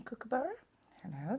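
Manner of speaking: ordinary talk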